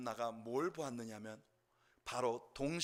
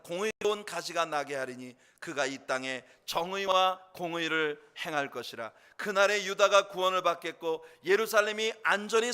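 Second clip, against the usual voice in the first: scripture reading; 3 semitones higher, 8 dB louder than usual. Acted. Pastor reading Jeremiah to the church, loud proclamation. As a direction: proclaim the verses to the congregation.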